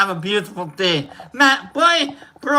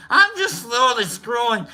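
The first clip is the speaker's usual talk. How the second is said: normal talking voice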